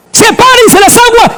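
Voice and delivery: high, ringing divine command; voice of God commanding. Acted. Preacher voicing God's command at creation. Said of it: "todo Dios lo creó con su palabra".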